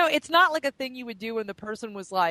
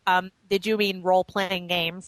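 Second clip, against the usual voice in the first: nerd voice. Acted. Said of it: "do the nerd voice".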